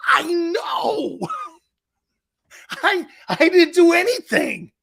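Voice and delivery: high, loud, tearful "I didn't do anything"; imitating crying friend. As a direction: voice breaking high and loud as if sobbing. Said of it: "he starts crying".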